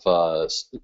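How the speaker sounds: ordinary delivery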